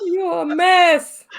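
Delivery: high, slow, drawn-out tease through laughter; amused scolding. Real, not acted. Host teasing the giggling co-host, high amused scolding. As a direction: tease a friend who is cracking up.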